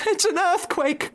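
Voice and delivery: very high alarmed cry; a mother fox crying alarm; cried out. Acted. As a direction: gasp it out in panic. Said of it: "cried Missus Fox".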